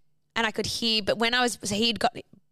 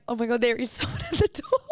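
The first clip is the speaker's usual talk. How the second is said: high retold shock collapsing into laughter; laughing disbelief; 4 semitones higher than usual. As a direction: act shocked, then crack up on the last words.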